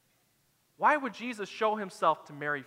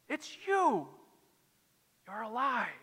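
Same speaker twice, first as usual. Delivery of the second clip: hushed, high-pitched amazement; amazed recognition; 4 semitones higher than usual. Acted. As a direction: let the astonishment soften into awe. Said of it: "she turns around instantly and says".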